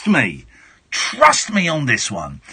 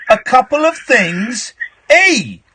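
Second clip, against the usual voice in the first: higher, louder, slow teasing imitation; mocking drawn-out repeat; 7 semitones higher, 3 dB louder, slower than usual. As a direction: stretch it out to mock the caller. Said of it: "that's what it sounded like".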